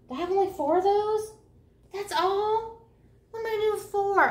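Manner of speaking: high, loud dismay; real disappointed outcry; dismayed surprise. Real, not acted. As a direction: protest at having only four. Dismay at only four skeins.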